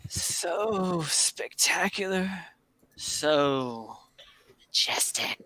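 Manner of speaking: slow reverence fading into a whisper; hushed awe. Acted. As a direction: trail into a breath of wonder. Old Flippin awed by his memory.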